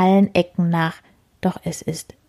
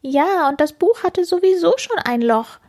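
much higher tiny beetle voice; little beetle; 10 semitones higher than usual. Acted. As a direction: excuse it in a tiny bug voice. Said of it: "meint der kleine Käfer".

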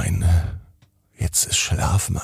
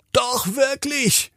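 sehr hohe, kindliche Stimme; Kinderstimme Basti; slower than usual. Acted. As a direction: wie ein kleiner Junge, hoch und fröhlich. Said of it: "ruft Basti von oben herunter". (der kleine Bruder ruft von oben zu Tina, sehr hoch)

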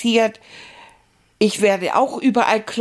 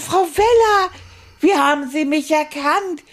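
surprised; 6 semitones higher than usual. Acted. Puppet amazed at being recognized.

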